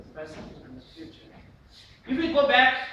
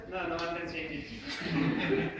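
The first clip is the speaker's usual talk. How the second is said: slow refusal breaking into laughter; mismatched laughing delivery; 3 semitones lower, 4 dB louder, slower than usual. Shown as a demonstration. Teacher shows expression not matching the thought, laughing through it. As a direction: say the refusal laughingly, undercutting the anger. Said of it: "How it is?".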